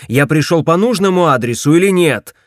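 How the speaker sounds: loud indignant demand. A narrator's reading. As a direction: demand it loudly. Indignant demand after a bark.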